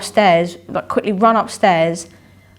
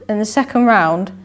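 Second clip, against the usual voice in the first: usual speaking voice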